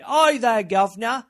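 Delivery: loud, high-pitched mock British Cockney. Author showing narrators' distracting accents, hammed-up loud Cockney.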